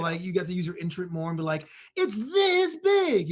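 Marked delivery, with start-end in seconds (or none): 1.9-3.3 high, loud, drawn-out and very slow; expressive Italian-style exaggeration. Shown as a demonstration. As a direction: stretch every word like you're waving your hands. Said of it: "you got to be more expressive".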